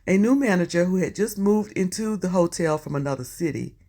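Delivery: ordinary talk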